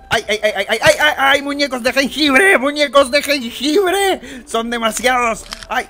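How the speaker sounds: high panicked yelps; panicked shouting. Acted. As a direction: yelp in playful panic. Lo atacan muñecos de jengibre. (gritos de pánico agudos)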